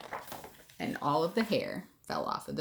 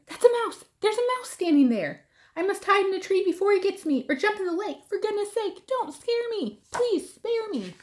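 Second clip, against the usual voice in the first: much higher and louder, panicking; frightened; 12 semitones higher, 5 dB louder than usual. A narrator's reading. Elephant terrified of the little mouse, high panicked pleading. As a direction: babble in panic, pleading to be spared.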